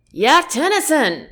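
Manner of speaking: cheery shout across the ship; calling out. Acted. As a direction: call across the deck to him. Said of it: "Lester calls".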